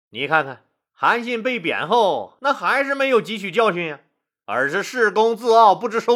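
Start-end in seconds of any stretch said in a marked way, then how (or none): none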